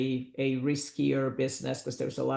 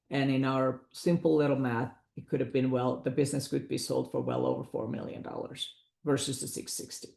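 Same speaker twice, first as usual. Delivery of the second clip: ordinary talk, nothing marked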